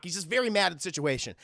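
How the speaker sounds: usual speaking voice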